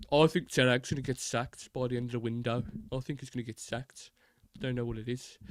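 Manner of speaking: Brummie pundit impression; Gabby Ogbonglahor. Pundit predicting a manager's sacking, Brummie accent. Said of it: "Like Gabby Ogbonglahor".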